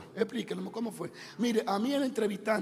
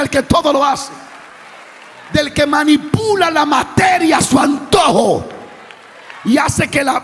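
loud, high, impassioned preacher's shout; full-force preaching; 7 semitones higher, 11 dB louder than usual. Real, not acted. Preacher telling how God turns Moses' staff into a serpent, shouted preaching. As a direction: build to a ringing, shouted climax.

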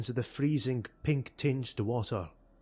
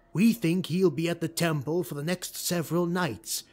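louder, insistent; urgent lord; 4 semitones higher, 4 dB louder than usual. Acted. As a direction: push back, no time to wait.

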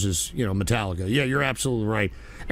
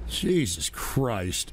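exasperated; 7 semitones lower, 5 dB quieter, much slower than usual. Real, not acted. Exasperated sigh.

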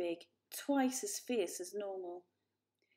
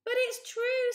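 much higher, louder plea; pleading kitten; 8 dB louder than usual. A narrator's reading. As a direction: insist desperately. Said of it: "said Varjak".

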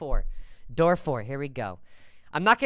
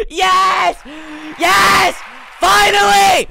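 very high, loud celebratory screams; overjoyed yelling at full volume; ecstatic shouting; 13 semitones higher, 12 dB louder, much slower than usual. Real, not acted. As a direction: scream it out in triumph. Celebrates long-awaited news.

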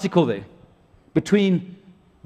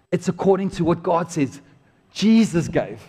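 ordinary delivery

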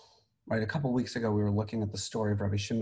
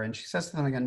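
ordinary talk

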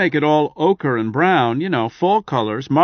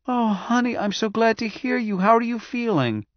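much higher, gushing and glad; pleased wife; 10 semitones higher than usual. A narrator's reading. Wife greets husband on phone, high and delighted. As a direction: pour out joy at hearing him. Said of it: "The pleasure in her voice".

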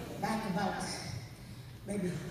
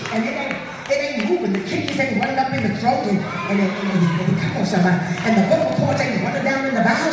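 building preaching; 5 semitones higher, 11 dB louder than usual. Acted.

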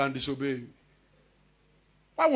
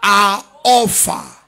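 slow, raised, ringing scripture proclamation; proclamatory preaching; 6 semitones higher, 9 dB louder, much slower than usual. Real, not acted. Preacher reads the translation to his congregation, proclamatory preaching. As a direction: declaim it in big, drawn-out phrases.

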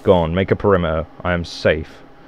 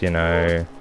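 usual speaking voice

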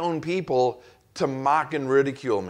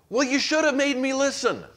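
exasperated boss; 12 semitones higher than usual. Retold or imitated. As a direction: bark the question like a boss who won't listen. Boss blaming employee, acted exchange.

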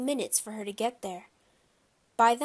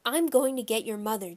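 plain reading voice

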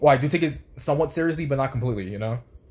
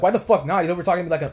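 higher, louder protest; retold indignant comeback; 6 semitones higher, 4 dB louder, faster than usual. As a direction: argue back in raised-pitch disbelief.

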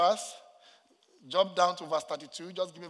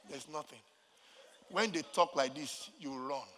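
normal talking voice

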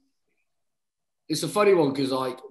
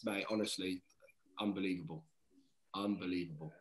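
much softer and lower, fading; moved, quiet; 5 semitones lower, 11 dB quieter than usual. Real, not acted. Player telling of hugging his crying family, quietly moved. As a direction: let it fade out, overwhelmed. Emotional about family moment.

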